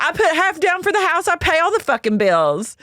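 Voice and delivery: high-pitched mock outrage; hammed-up shrill retort; Brittany imitation. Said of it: "She goes".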